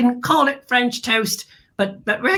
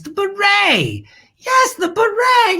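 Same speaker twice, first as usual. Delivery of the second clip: savoured beret; 8 semitones higher, slower than usual. Acted.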